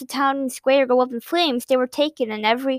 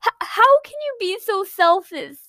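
soft tearful question; 9 semitones higher than usual. A narrator's reading. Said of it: "she asked softly".

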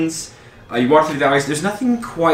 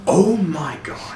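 slightly slow, drawn-out amazed reaction; lightly voiced companion; 5 semitones higher, 3 dB quieter, slower than usual. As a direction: stretch it out in mild mock awe. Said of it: "She's like".

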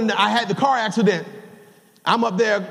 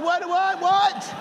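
mock shock; 7 semitones higher, slower than usual. Mock outrage at cookie price.

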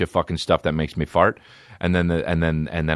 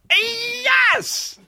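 much higher falsetto squeal of joy; mock excited squeal. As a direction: shriek it like a giddy fan.

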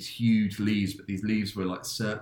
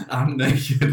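laughing quote; 5 semitones higher, 8 dB louder than usual. Retold or imitated. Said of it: "just like".

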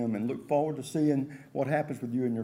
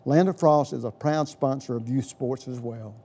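upbeat, louder radio ad read; chipper 1950s ad; 3 semitones higher, 8 dB louder than usual. Acted. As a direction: sell it like a retro radio spot. Host reads sponsor ad.

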